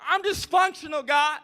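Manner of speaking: high, pleading Moses voice; whiny Moses. Retold or imitated. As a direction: plead with God in a high, small voice. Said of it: "Moses is like".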